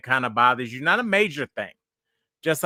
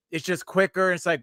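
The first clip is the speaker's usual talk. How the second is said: ordinary delivery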